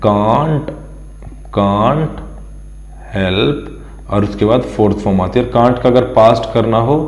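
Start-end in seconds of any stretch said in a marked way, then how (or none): 0.0-3.7 "can't" and "help" set apart with pauses, each rising; stressed key words. Shown as a demonstration. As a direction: drill the phrase word by word. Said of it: "एक word याद रखना है".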